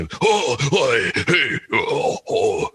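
guttural British huffing noises; wordless British bluster. Shown as a demonstration. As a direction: harrumph like a stout British gent. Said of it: "voice you can do and not say a single word".

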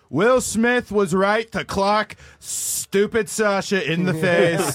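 slow comic politician impression; Trump. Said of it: "there's a Trump video of him commenting on it".

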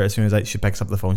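ordinary talk

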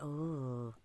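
lightly mimed relaxing; drowsy sigh. Retold or imitated. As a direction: melt into sleepy calm. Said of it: "I was like".

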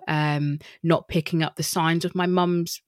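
ordinary talk, nothing marked

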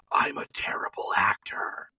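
low confidential whisper; John Malkovich. Retold or imitated. Malkovich confessing to Damon after the take, low whisper. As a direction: lean in and whisper a secret confession. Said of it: "the lean in, "Come close to me".

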